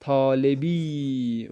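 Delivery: طالبی stretched slowly; teacher models طالبی slowly; slow model word. Shown as a demonstration. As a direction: give طالبی slowly to the class. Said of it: "اس کو ہم پڑھیں گے".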